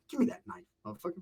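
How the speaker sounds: a hushed menacing demand. Retold or imitated. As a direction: threaten him quietly up close. Grabbing him by the neck.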